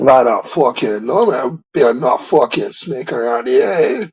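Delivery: broad Aussie bushman voice, crikey and mate; Australian accent. Acted. Gamer to friends, hamming a broad Australian accent. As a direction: play a rough outback croc hunter. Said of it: "can't do a Scottish".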